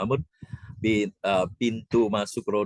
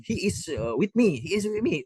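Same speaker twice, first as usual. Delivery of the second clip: higher, insistent English; 11 semitones higher than usual. Retold or imitated. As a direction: vouch for him at the gate.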